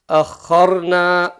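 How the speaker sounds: very slow akharna; slow blended demo. Shown as a demonstration. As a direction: draw out akharna syllable by syllable. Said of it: "blending syllables demo".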